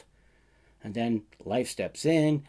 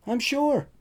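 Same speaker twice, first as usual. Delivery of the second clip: insistent; 12 semitones higher, 4 dB louder than usual. Acted. Narrator voicing himself, insistent.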